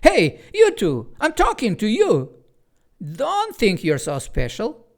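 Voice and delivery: high, loud bossy shout; girl yelling after the two creatures, commanding; nasty commanding yell. A narrator's reading. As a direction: order them back. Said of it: "she yelled in a nasty, commanding tone".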